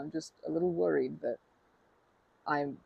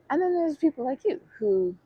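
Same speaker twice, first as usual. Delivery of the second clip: higher, louder eager opening; excited; 10 semitones higher, 7 dB louder than usual. Real, not acted. Speaker turns excitedly to the silent river-watcher. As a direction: burst in with eager enthusiasm. Addressing the quiet listener warmly.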